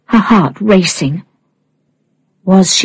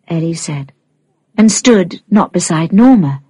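ordinary delivery

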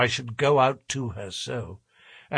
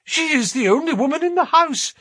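very high, urgent character voice; agitated Sholto; 14 semitones higher, 5 dB louder than usual. A narrator's reading. Sholto tells companions to wait, high agitated voice. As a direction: pitch it way up, louder and urgent.

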